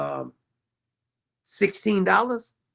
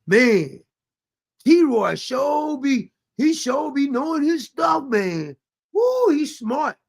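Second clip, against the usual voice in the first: higher, slow dopey admiration; mocking gullible fan; 5 semitones higher, much slower than usual. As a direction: play a starstruck fan, slowly. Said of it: "somebody's probably in his comments saying".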